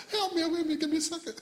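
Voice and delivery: high-pitched words squeezed through laughter; helpless laughing. Real, not acted. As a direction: beg for a moment while cracking up. Cannot stop laughing.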